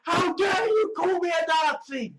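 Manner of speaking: high-pitched, shouted indignation; indignant outburst. Acted. Accused person hits back at being called a Nazi, indignant. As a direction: shout it as an outraged protest.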